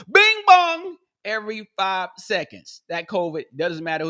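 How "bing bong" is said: very high, loud doorbell chime; bing bong chime. Pretend. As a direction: ring it like a chime. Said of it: "the sound covid making".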